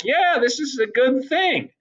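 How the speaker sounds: mock Federalists approving. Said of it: "They're like".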